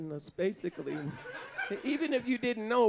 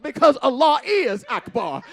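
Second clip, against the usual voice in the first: loud, rousing church cry; preacher shout; 5 semitones higher, 10 dB louder than usual. Retold or imitated. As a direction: preach it to the back pews. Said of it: "I said".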